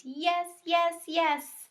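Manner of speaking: high, excited hamster squeak; loud squeak. A narrator's reading. Hamster squeaking loudly about the twins. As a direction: squeak it out with joy. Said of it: "I loudly squeaked".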